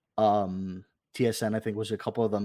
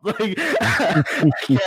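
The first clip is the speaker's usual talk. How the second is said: laughing; 7 semitones higher, 7 dB louder, much slower than usual. Real, not acted. Laughing while retelling nervous moment.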